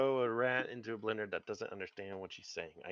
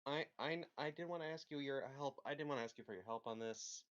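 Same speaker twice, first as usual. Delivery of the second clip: much softer, higher timid voice; meek plea; 4 semitones higher, 11 dB quieter than usual. Acted. GM's character asking the player for help, much softer and higher. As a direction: ask for help shyly, almost under breath.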